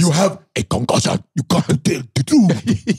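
hammed-up mock Nigerian accent; Will Smith's Nigerian accent. Joking about Concussion with a friend, exaggerated Nigerian accent. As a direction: parody the film line in a thick Nigerian accent. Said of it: "Will Smith doing an acce-, accent".

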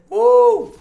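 sudden loud high pop-star woo; high falsetto woo. Real, not acted. Streamer adds a singer's ad-lib, high falsetto woo. Celebrates while singing.

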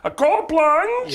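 put-on high, loud sing-song Swedish accent. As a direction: play a cartoon Swedish man, slow and high. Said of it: "your impression of what you believe a Swedish".

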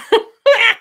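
loud high laugh at her own joke; real laugh; burst of laughter. Real, not acted. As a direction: crack up laughing.

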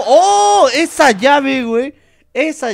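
loud, high fan shout; excited shout. Real, not acted. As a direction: hype up the hold. Cheering a wrestling hold.

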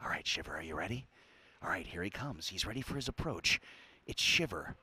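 hushed, golf-style whispered play-by-play. Real, not acted. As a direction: drop to a whisper so you don't disturb the player. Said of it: "You have to whisper".

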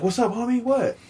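high, loud challenge, acted out; mock confrontation. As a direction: imitate someone squaring up for a fight. Imitated confrontation after a skateboard hit.

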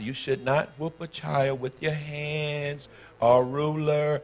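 slow, drawn-out lecturing of a pastor; mock scolding. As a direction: mimic a prim objector, word by slow word. Mimics a critic lecturing him.

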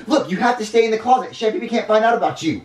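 put-on high, loud, bossy-kid voice; urgent kid character. Junior hides his rat from the chef, urgent.

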